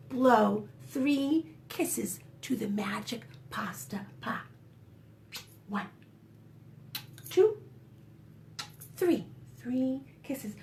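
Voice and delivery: secretive whispered narration. A narrator's reading. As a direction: hush it like a secret. Secret magic shared with children.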